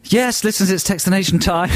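high-pitched, lively radio intro; own catchphrase. Shown as a demonstration. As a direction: announce it as a showy stock phrase. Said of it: "Well, you always say".

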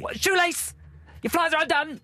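shouting. Retold or imitated. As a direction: yell it to throw them off. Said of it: "then I'd shout".

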